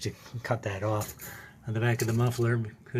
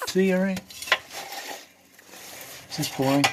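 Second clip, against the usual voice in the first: louder, higher call from under the car; 9 semitones higher, 4 dB louder than usual. Real, not acted. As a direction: call it out to the camera.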